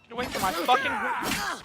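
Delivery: shouting in frustration during a fight; angry yell. Real, not acted. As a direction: yell at the attacker in real rage. Raging at enemy stealing horse.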